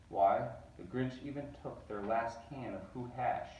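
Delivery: ordinary delivery, nothing marked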